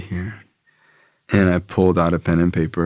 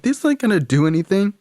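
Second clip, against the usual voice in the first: scoffing complaint; 13 semitones higher than usual. Retold or imitated. Scoffing at pills.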